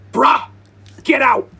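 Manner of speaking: very high, piercing full-force scream. Retold or imitated. Kid who barged into the bedroom, screaming at the owner. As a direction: scream each burst loud and high. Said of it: "walked straight into my bedroom and screamed".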